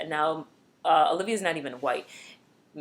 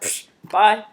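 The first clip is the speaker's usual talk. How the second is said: scornful brush-off; 3 semitones higher, 8 dB louder than usual. Retold or imitated. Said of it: "and she's like".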